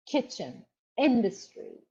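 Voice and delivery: ordinary talk, nothing marked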